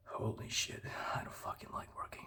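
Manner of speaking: whispered aside. Real, not acted. Vlogger whispers a confession about hating work. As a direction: breathe it out in a whisper. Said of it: "whispered aside".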